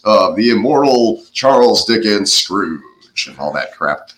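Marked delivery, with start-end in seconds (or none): 0.0-2.8 slow, grand TV announcer delivery; drawn-out mock telecast introduction. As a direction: imitate a broadcast announcer billing a show. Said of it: "the announcer's like".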